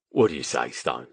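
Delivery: low Cockney prompt; thick Cockney accent. A narrator's reading. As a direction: press a mate in low Cockney. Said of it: "in a thick Cockney accent".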